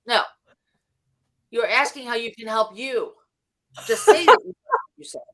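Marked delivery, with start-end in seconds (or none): 3.9-5.2 high, loud, sarcastic jab; mocking punchline. Real, not acted. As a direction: sneer the advice upward. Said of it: "high mocking punchline".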